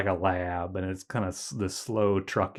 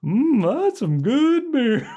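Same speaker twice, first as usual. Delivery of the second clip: brain in a jar; 6 dB louder, much slower than usual. Retold or imitated. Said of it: "He's like".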